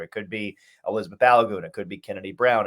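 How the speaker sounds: normal talking voice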